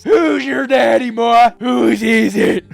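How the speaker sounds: triumphant shout. Acted. Dominating shout at victim.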